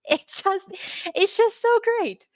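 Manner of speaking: high, gleeful exclamation; delighted. Real, not acted. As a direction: squeal with glee at the headline. Delighted by the headline.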